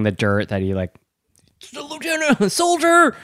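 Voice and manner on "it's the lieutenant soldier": high comic soldier bark; mock soldier. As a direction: play a soldier saluting the lieutenant. Playing a soldier reporting.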